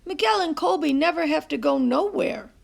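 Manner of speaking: lightly voiced boy complaining; boy's complaint. A narrator's reading. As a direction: grumble like a bored kid. CJ keeps complaining.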